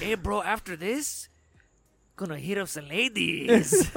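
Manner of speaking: slow, drawn-out mock Mexican accent. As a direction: stretch the words in a put-on Mexican accent. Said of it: "my Mexican voice on".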